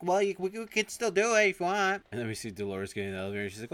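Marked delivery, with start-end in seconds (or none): 0.0-2.0 high, loud hesitant put-on voice; sheepish character voice. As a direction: fumble an awkward offer in a silly voice. Character backs down, offering.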